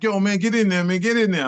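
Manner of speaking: acted-out friend cheering him on; egging on. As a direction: push your buddy to jump in. Friends urging him into the circle.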